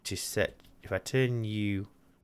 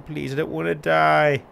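higher, louder plea, last word stretched; desperate begging; 6 semitones higher, 5 dB louder than usual. Real, not acted. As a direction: beg for your life. Begging not to die in game.

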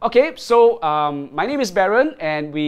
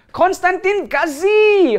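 high, loud, drawn-out name call; excited announcement; 8 dB louder, slower than usual. Real, not acted. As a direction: shout the winner out.

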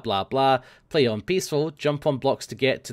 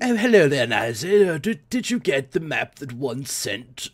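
posh English impression; slower than usual. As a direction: lay on a posh English accent for James. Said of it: "James is actually English, so it's like".

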